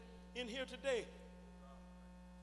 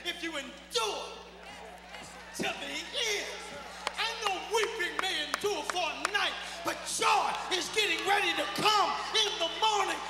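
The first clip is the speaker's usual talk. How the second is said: loud, climbing preacher's call, higher at the peak; full-force preaching; 5 semitones higher, 12 dB louder than usual. Acted. Preacher promising joy in the morning, preaching at full force. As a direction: lift the church to its feet.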